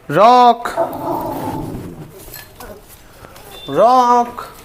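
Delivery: calling out. Real, not acted. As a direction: call the name out into the distance. Calling for the missing dog.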